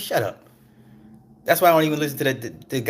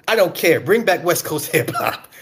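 louder, higher stubborn demand; mock demanding customer; 5 semitones higher, 5 dB louder than usual. Customer demanding West Coast hip hop, loud. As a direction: insist like an impossible customer. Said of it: "that customer".

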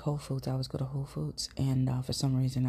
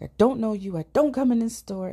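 high, louder agitated protest; mock raging lunatic; 6 semitones higher, 6 dB louder than usual. Confronting the store lady, hammed-up agitated insistence. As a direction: insist hotly you don't know her. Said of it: "being like a raging lunatic".

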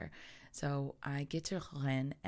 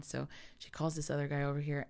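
ordinary talk, nothing marked

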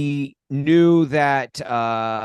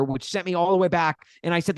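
normal talking voice

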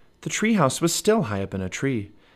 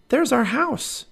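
put-on high excited girl's voice; little girl Annie; 14 semitones higher, 3 dB louder, slower than usual. Annie calls to Jack, high excited little girl. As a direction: Annie calling out, bright and high.

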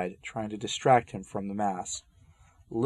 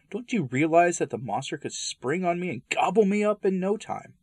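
frightened protest; 9 semitones higher than usual. Acted. Frightened refusal of the snake.

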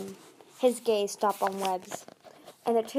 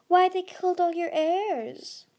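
high, slow, louder coaxing; purring; 9 semitones higher, 5 dB louder than usual. A narrator's reading. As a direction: purr it out slowly, leading them on. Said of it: "Blister purred".

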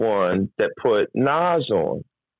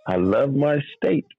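mock sob; 2 semitones higher than usual. Mock lament.

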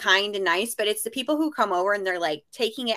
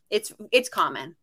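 usual speaking voice

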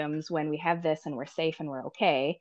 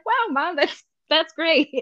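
much higher, slow mock-polite wow; forced enthusiasm; 11 semitones higher, 4 dB louder than usual. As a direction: humor mom with overdone praise. Reacting to mom's Pride merch.

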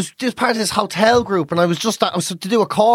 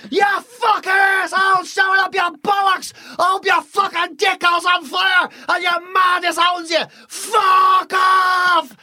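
high, slowed-down furious rant; mock terrace abuse; 7 semitones higher, slower than usual. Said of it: "We never get to look at".

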